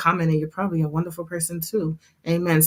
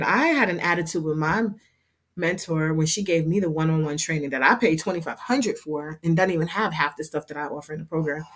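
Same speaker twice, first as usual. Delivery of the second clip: ordinary delivery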